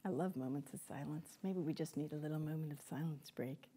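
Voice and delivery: very soft, low aside; quiet, low musing after a long pause; hushed. Real, not acted. Pauses, invites a moment of silence.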